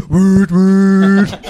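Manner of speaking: loud foghorn imitation "wüt, wüt"; ship horn noise. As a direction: honk like a tanker horn. Ship horn imitation after tanker. (laut wie ein Schiffshorn tuten)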